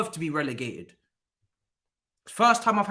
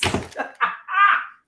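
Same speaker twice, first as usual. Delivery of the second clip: incredulous shriek-laugh; 14 semitones higher, 5 dB louder than usual. Real, not acted. Fan reacts to his team.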